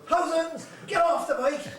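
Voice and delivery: schoolboy yelling a nickname at a man on a bike, loud and high; shouted out. Retold or imitated. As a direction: bellow the name at the cyclist. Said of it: "Knocky shouts out".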